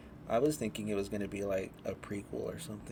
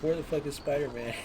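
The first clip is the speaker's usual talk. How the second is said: higher, louder imitation of mad fans; mock indignant outcry; 6 semitones higher, 5 dB louder than usual. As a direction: raise the pitch in mock outrage. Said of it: "people on Twitter are mad".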